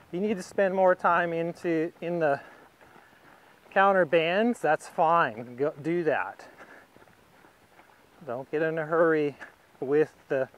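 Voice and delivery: ordinary talk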